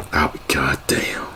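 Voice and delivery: slow, whispered reaction; hushed disbelief. Retold or imitated. Scared man to his friend, hushed disbelief. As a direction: whisper it slowly to himself.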